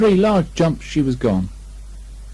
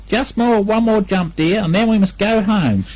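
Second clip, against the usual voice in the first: higher, fond mother voice; mother kangaroo; 10 semitones higher than usual. A narrator's reading. Kanga coaxes Roo, higher fond mother voice. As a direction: coax the little one home.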